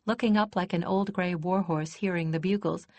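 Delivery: ordinary delivery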